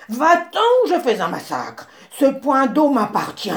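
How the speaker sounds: chasse-le fort, en grondant; babouin grognon. A narrator's reading. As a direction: ordre hargneux. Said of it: "grogna le babouin". (le babouin gronde contre le zèbre)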